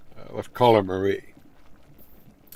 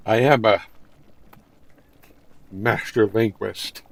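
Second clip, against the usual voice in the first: mock grand boast; 7 dB louder than usual. Joking to chat about his wording, hammed-up boast. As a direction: puff up like a proud scholar. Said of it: "Why, yes".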